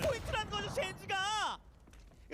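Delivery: high, loud shouting call; excited shout. Real, not acted. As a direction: yell it out as the kill lands. Caster shouts at the kill.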